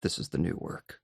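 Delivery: unvoiced, flat quote; whispered deadpan. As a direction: breathe it out deadpan like Dodd. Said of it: "I'm gonna remember this line".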